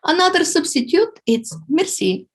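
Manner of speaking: ordinary delivery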